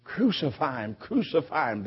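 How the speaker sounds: ordinary delivery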